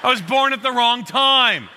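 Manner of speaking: hammed-up grudge about childhood; a hint of comic bitterness; mock bitterness. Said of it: "so bitter about".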